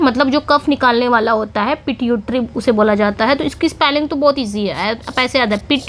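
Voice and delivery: ordinary talk, nothing marked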